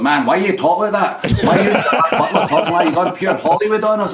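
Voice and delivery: put-on high, loud teasing Scottish accent; mock Scottish accent. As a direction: play the Scot mocking a Hollywood twang. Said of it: "Me and Gerard Butler, it's like".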